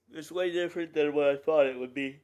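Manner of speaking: words stretched by a yawn. Real, not acted. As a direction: talk through a yawn. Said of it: "I keep yawning".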